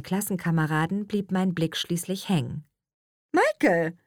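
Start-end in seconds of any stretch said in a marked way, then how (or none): 3.3-4.0 delighted, higher-pitched greeting; happy call of recognition. A narrator's reading. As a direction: call out, pleased to see him. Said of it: "rief ich erfreut".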